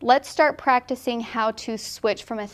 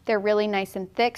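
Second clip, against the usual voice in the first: usual speaking voice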